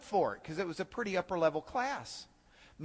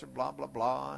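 ordinary talk, nothing marked

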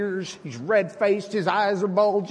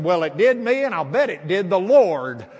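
louder, pointed comeback; indignant retort; 7 dB louder than usual. Retold or imitated. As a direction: throw it back at him, louder and heated. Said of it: "he's steaming mad".

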